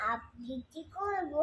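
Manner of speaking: ordinary talk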